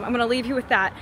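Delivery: normal talking voice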